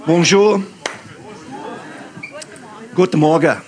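ordinary talk

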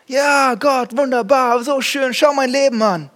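hammed-up happy believer; fake cheerful churchgoer. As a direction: sell the act of a perfect life. Hypocritical churchgoer voiced.

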